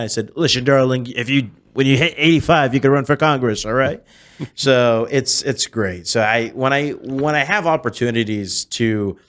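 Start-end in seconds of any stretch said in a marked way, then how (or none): 0.3-4.0 higher, emphatic impression with slurred s; Donald Trump. Impersonator advising an elderly heckler, emphatic Trump voice. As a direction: lecture her in the politician's voice. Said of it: "I'm not actually Trump".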